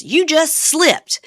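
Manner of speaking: drawn-out, high, loud gotcha; accusing. Retold or imitated. Wife catching husband in a lie, accusing. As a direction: imitate pouncing on a slip, slowly.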